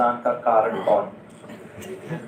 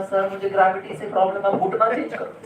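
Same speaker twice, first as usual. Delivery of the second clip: mock exasperated plea; 8 semitones higher, much faster than usual. Pleading with the doctor.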